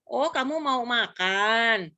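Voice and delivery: put-on slow, drawn-out question to a toddler; correcting parent. Parent correcting a toddler's word for eating, slow clear speech. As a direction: gently model the proper word MAKAN, stretched. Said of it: "yang harus ngebenerin".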